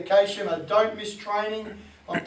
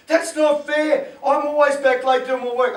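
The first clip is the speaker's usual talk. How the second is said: mock sulky swimmer protesting; whiny athlete; 6 semitones higher, 8 dB louder than usual. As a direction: drag out the complaint like a sulky teen. Athlete whining at coach.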